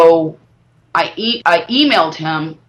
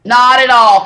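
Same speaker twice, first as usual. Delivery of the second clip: echoing "Not at all" louder and higher, approving; mock approving echo; 5 semitones higher, 7 dB louder than usual. Shown as a demonstration. Praising a friend's answer, mock approving echo. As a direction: repeat the answer back like a pleased teacher. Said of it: "You said the right words".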